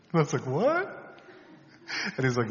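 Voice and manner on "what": high-pitched, rising mock disbelief; retold incredulous reaction. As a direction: shoot the question sharply upward in surprise. Retold stunned reaction to confession.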